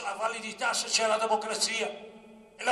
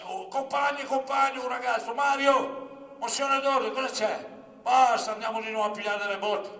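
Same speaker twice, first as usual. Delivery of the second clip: calling out; 3 semitones higher, 7 dB louder than usual. Retold or imitated. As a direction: call out to comrades across a crowded assembly. Acting out student assembly interjections. (richiamo concitato ai compagni)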